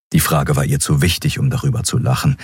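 ordinary delivery

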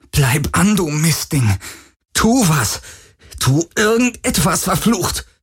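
high, frantic cursing at a dead device; desperate pleading. Acted. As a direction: beg and curse at once.

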